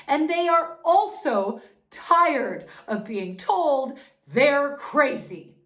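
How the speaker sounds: indignant outburst. Acted. Mother venting at doctors calling parents crazy, indignant and loud. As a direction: spit out the frustration. Angry about being dismissed.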